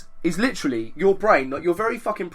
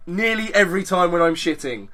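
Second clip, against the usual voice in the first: ordinary talk, nothing marked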